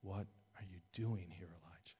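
low, very soft, fading to a hush on Elijah; still small voice. Acted. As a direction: ask it gently, barely audible. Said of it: "a still small voice".

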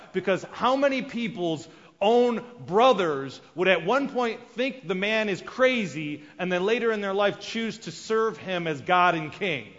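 ordinary talk, nothing marked

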